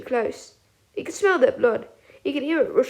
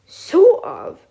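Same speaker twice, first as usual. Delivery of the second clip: high, loud scornful scoff; 8 semitones higher, 11 dB louder than usual. A narrator's reading. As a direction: scoff at the words. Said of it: "Sirius scoffed".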